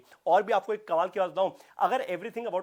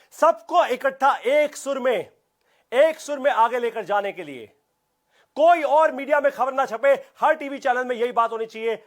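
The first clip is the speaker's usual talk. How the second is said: rallying call; 5 semitones higher, 5 dB louder than usual. Real, not acted. Rallying viewers for Ratan Tata.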